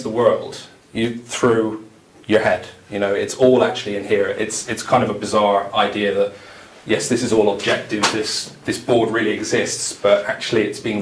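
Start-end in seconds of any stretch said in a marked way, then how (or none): none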